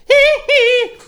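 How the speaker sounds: loud falsetto "hee hee"; two very high, punched little squeals. Acted. Showing the co-host his impersonation, high squeal. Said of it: "I do a good [name] impersonation".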